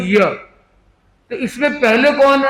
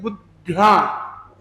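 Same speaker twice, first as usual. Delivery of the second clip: बुद्ध्या slowly, with weight on बुद्; stress on first syllable; 3 semitones lower, much slower than usual. Shown as a demonstration. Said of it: "ऊ जो है यहाँ पे थोड़ा प्रेशर आएगा". (बुद्ध्या धीरे, बुद् पर ज़ोर)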